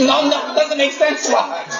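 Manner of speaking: laughing through a protest; real giggle over the words. Real, not acted. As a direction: say it as the laugh breaks in. Laughing at the excuse.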